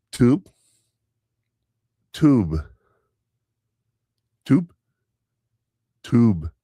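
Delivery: repeating "tube" with a long oo, loud and rising; mom's drawn-out tube. Retold or imitated. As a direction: hammer "tube" with a stretched oo each time. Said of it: "she tells him".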